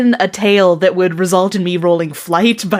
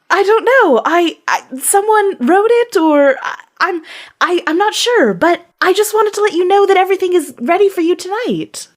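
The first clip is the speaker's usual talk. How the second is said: nervous apology; 8 semitones higher than usual. Acted. Understudy apologising to angry diva.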